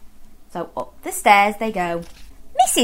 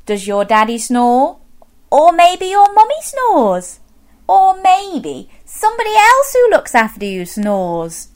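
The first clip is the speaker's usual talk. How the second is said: ordinary delivery, nothing marked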